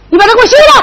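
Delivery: high, forceful order; loud demand. Acted. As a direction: bark out the command. Mother demands son divorce wife. (高声命令)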